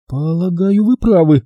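high-pitched, polite female voice; courteous lady's agreement; politely agreeing woman. Acted. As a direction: concede politely in a high register. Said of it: "вежливо согласилась она".

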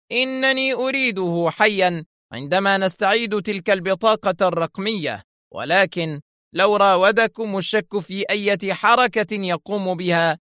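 high-pitched, insistent orders; commanding. A narrator's reading. Shimon orders his men to guard prisoners, commanding. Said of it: "مضيفا بلهجة آمرة".

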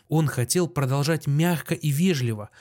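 ordinary delivery

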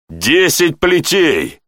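voiced hiss of anger; hissed threat. A narrator's reading. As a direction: pronounce the punishment with drawn-out menace. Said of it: "прошипел Тьерри".

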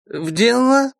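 put-on high slurred drunken question; drunk with hiccups. A narrator's reading. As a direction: slur it with a hiccup. Drunk viscount slurs.

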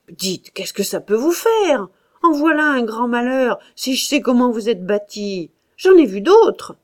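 losing patience, building to a shout; growing anger. A narrator's reading. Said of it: "lui se fâchait peu à peu". (colère qui monte, de plus en plus fort)

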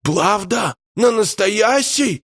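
put-on very high, wondering child voice; high childish questions, drawn out at the end; disbelieving little boy. A narrator's reading. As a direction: ask like a kid who can't quite believe it. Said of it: "недоверчиво поинтересовался мальчуган".

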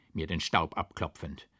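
ordinary delivery, nothing marked

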